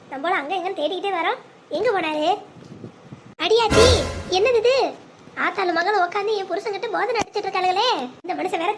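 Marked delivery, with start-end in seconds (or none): 3.4-4.7 loud, raised burst of disbelief; shocked exclamation. Acted. Wife catches her husband talking with his mother, shocked. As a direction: exclaim loudly in startled outrage.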